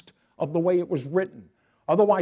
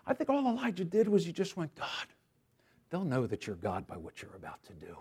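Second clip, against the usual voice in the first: near whisper; 9 dB quieter than usual. Retold or imitated. Elijah's quiet prayer for fire, soft hushed whisper. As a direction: breathe the prayer almost silently. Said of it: "no more than a whisper".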